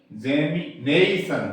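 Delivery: इग्जेमिनेशन with a loud, long "NEI"; slow इग्जेमिनेशन, main weight on "nei"; primary stress. Shown as a demonstration. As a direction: punch and stretch the "ne" in इग्जेमिनेशन. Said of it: "बल इस पे ज्यादा लगेगा".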